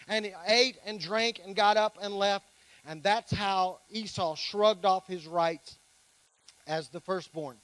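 preacher reading Genesis aloud to the church; scripture reading. Acted. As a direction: read the passage out to the congregation with weight.

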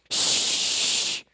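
sharp unvoiced shush to scare cats; loud shush. Shown as a demonstration. Shooing cats away with a loud shush. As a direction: hiss a loud shh at a cat. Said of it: "You go, Real loud".

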